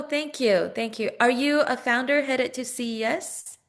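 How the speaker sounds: usual speaking voice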